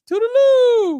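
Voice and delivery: loud, very high sing-out farewell; Missus Doubtfire. Acted. Joking about dressing up as a nanny, loud falsetto farewell. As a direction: trill it out in a big falsetto.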